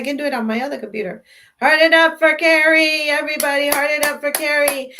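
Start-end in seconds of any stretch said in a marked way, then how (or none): 1.6-4.9 much higher and louder, rallying the crowd; excited cheering. Real, not acted. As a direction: whip up the audience for Carrie. Cheering viewers on for winner.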